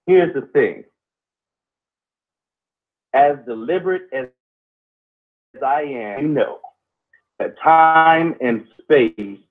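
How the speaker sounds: slow, deliberate oration with long pauses; spoken-word pondering. Acted. Co-host expounds on history and time, slow deliberate oration. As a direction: weigh each phrase, pausing heavily between them. Improvised philosophical monologue on history.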